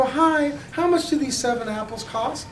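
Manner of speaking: high, chirpy shopper asking the price; polite customer. Acted. A customer asks the Trader Joe's clerk the price, high and polite. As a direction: sweetly ask the clerk what the apples cost.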